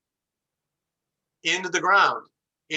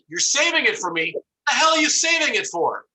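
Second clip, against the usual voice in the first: rising to a high, louder outraged cry on hell; indignant customer, pitch climbing sharply; outraged disbelief; 8 semitones higher, 3 dB louder than usual. Retold or imitated. Outraged reply to the ATM.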